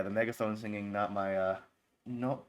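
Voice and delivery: normal talking voice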